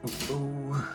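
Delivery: usual speaking voice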